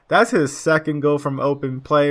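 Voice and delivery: usual speaking voice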